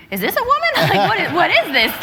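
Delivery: baffled disbelief. Retold or imitated. Recounting first sight of Kiki on stream, baffled disbelief. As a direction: ask it bewildered, pitch jumping up. Retells her startled reaction on stream.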